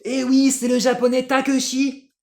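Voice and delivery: high, loud excited sports commentator; Nelson Montfort. Retold or imitated. French commentator calling the ski jump, excited high shout. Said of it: "on avait Nelson Montfort".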